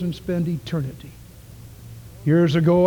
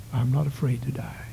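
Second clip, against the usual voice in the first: whispered repeat; 2 semitones lower, 6 dB quieter than usual. Acted. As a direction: let it sink in, barely voiced. Boy's words repeated, softer.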